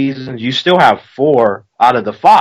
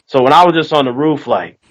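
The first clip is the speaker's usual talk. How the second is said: ordinary talk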